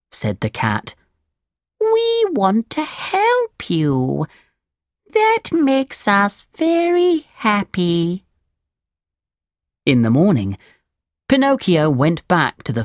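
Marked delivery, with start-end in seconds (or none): 1.8-8.2 high, slow, drawn-out cat voice; storybook cat, high and lingering; coaxing cat. A narrator's reading. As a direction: stretch each word as a high-voiced cat. The cat feigns kindness.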